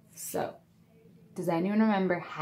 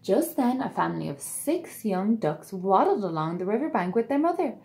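put-on lively read-aloud to children; storybook narration; 2 semitones higher, 3 dB louder than usual. A narrator's reading. Narrator telling children the tale, bright and lively. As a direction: read it like bedtime story time.

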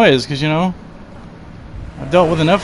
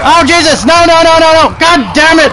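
panicked shouting; 11 semitones higher, 5 dB louder, faster than usual. Real, not acted. Attacked, screaming.

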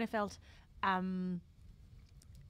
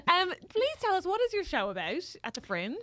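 ordinary talk

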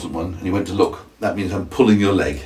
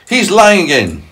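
high, loud earthworm accusation; indignant shriek; 11 semitones higher, 6 dB louder, slower than usual. Acted. Earthworm accusing the centipede, high mild shriek. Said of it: "shrieked the earthworm".

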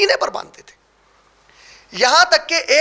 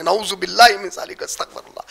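whispered; 8 semitones lower, 6 dB quieter than usual. Real, not acted. Seeking forgiveness under breath.

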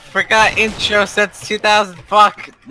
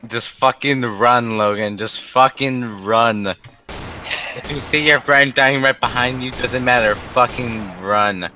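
slow deadpan advice; 7 semitones lower than usual. Real, not acted.

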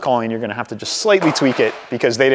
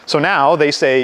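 ordinary delivery

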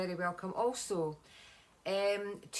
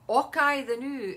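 loud, higher broad Scots exclamation; imitating broad Scottish street talk; Scottish slang; 5 semitones higher, 9 dB louder than usual. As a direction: call it out in hammed-up Scottish slang. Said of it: "like the way people talk".

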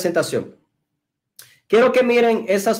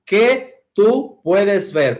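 "¿Qué tú puedes ver?" said very slowly, each word apart; patient teacher spacing out the words; much slower than usual. Shown as a demonstration. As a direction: spell out the four words slowly. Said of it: "podemos decir".

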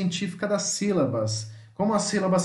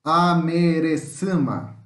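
"Amerecama" drawn out at half pace; slow word demonstration; 3 dB louder, much slower than usual. Shown as a demonstration. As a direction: sound out "Amerecama" slowly, stretching the syllables. Teacher sounds out long word.

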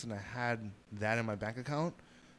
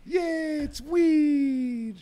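squealed high yay; hammed-up excited squeal; mock cheer; 8 dB louder, much slower than usual. Mock cheer launching show.

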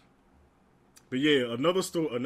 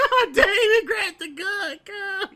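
high, loud, laughing through the words; can't hold the laughter in; 8 dB louder than usual. Real, not acted. Laughing at the memory.

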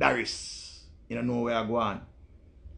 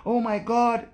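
much higher, fussy voice clutching pearls; high pitched scandalised onlooker; shocked prim viewer; 10 semitones higher than usual. Retold or imitated. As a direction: play a shocked prude gasping at cussing. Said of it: "And them say".

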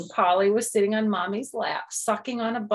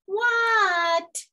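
yelled reply from afar; shouted answer to mom; high loud shouted what; 5 dB louder than usual. Acted. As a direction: shout back across the yard. Froggy answers call.

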